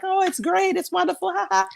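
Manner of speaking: very high, sing-song fake-happy voice with a forced ha ha; squeaky mock cheerfulness, forced laugh at the end; mock fake cheer. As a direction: parody a warrior pretending everything's fine. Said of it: "be like".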